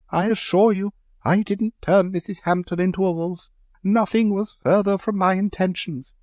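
high, loud earnest protest. A narrator's reading. Leonard protesting to the Colonel, defensive. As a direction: insist on his innocence. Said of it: "he protested".

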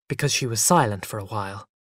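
plain reading voice

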